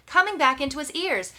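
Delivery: ordinary delivery, nothing marked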